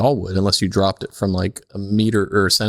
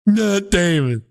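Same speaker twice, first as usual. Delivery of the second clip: mock Matt Damon shout; 11 semitones higher, much slower than usual. Friend answering the birthday quiz, shouting in a high mock voice. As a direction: bellow the name in a high put-on puppet voice.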